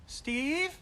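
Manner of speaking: high rising call down the stairs; lightly voiced girl calling Steve from the stairs. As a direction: call his name from above.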